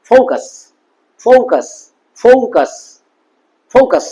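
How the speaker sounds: ফোকাস as 'fow-kus', the first vowel gliding; careful model ফোকাস, repeated with pauses; 'ou' diphthong. Shown as a demonstration. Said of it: "তারপরে দেখো ও ইউ".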